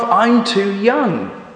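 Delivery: put-on high, slow baby calf voice; young calf. Calf pleading youth to Mister Gumpy, high and slow. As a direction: whine it slowly like a youngster. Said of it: "says the calf".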